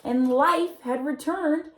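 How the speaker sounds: put-on gentle read-aloud for children, telling the tale; storybook reading. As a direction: read it like a bedtime picture book. Reading picture book aloud.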